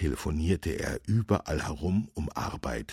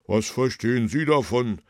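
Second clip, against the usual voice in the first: higher, loud, scornful challenge; indignant baker; 8 semitones higher, 7 dB louder than usual. A narrator's reading. Baker rebukes the young man, loud and indignant. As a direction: snub him with a raised voice.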